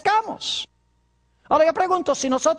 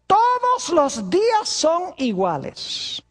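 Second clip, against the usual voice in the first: mock objector voice; 4 semitones higher, slower than usual. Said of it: "Algunas personas dicen".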